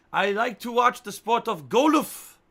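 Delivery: high, loud, slow, "golf" as "goh-luff"; over-enunciate, ending on two-syllable "golf", "goh-luff"; absurd "golf" pronunciation. Shown as a demonstration. Streamer clowning with the correction, exaggerated "goh-luff". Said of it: "Maybe it's golf".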